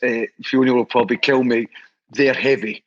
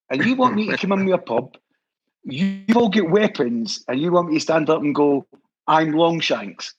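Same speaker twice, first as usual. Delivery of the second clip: mock incredulity; 3 semitones higher than usual. Retells friend's incredulous reply.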